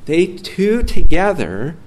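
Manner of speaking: preacher stressing the point; emphatic phrase. Real, not acted. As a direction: lean hard on each word. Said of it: "preacher stresses point".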